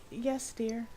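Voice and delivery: quietly obliging his wife; reserved husband. Acted. A hint of a meek husband answering his wife softly. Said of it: "he's kinda reserved and quiet".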